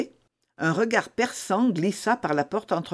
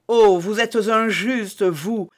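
cry out; 4 semitones higher, 6 dB louder, slower than usual. Acted. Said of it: "s'écria Milady".

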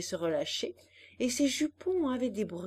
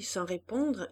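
plain reading voice

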